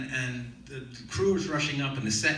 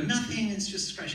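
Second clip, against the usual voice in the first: higher-pitched, mildly mock-brave denial; 10 semitones higher than usual. Hurt actor fobbing off the nurse, high and mock-brave. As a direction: brush it off a little too airily.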